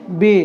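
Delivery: बे said as "bay", long e; name the letter बे, not ब; Urdu letter name. Shown as a demonstration. Teacher to students, says बे for the letter. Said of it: "यहाँ हम बे कह रहे हैं".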